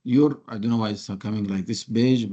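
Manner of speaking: ordinary delivery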